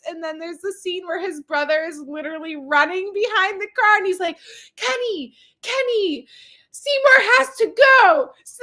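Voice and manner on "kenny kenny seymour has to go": high shouted call, slowing at the end; calling after car. Retold or imitated. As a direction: yell after a departing car. Brother running after car calling.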